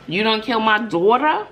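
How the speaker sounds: usual speaking voice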